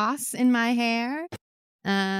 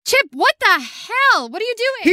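angry; 10 semitones higher, 3 dB louder than usual. Acted. Barmaid yells at intruding Chip.

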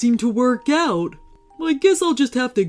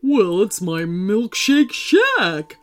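slow, drawn-out proud character voice; proud sea monster; 4 dB louder, slower than usual. Acted. Sea monster showing off his milkshake shack, proudly. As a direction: stretch it out with pride. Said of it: "replied Gordon proudly".

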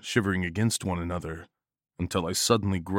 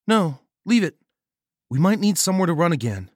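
female voice; 9 semitones higher, 6 dB louder than usual. A narrator's reading. Nagel decides.

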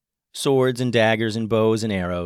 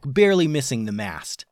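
usual speaking voice

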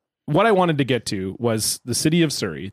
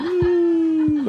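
mock cheer; 13 semitones higher than usual. Sarcastic cheer for turning thirty.

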